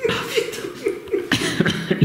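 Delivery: breathless squeaky laugh. Real, not acted. Laughing after swallowing a bug.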